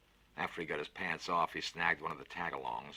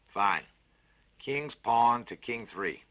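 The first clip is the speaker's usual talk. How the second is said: a big cheerful voice, lifted and louder; hearty Fats, louder and higher; 7 semitones higher, 11 dB louder, much slower than usual. Acted. Fats cheers up the scared boy with a bet, hearty and loud. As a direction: sell the bet with gusto. Konski calls chess move.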